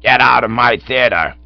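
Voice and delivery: slow, very loud order; bellowed. A narrator's reading. Manager throwing Cyril out, slow angry bellow. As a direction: bellow each word heavily. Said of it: "bellowed old Blumenfield, swelling a good bit more".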